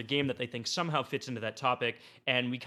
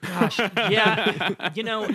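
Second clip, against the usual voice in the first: laughing, louder and higher; 5 semitones higher, 7 dB louder, much slower than usual. Real, not acted. Host answering the joke question about his crime syndicate, laughing. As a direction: chuckle through the reply.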